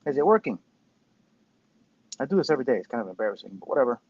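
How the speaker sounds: usual speaking voice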